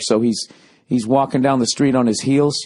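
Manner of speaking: ordinary talk, nothing marked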